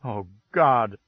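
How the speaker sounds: a desperate wife crying out; cried out. Acted. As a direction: break out of the calm with a cry. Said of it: "she cried".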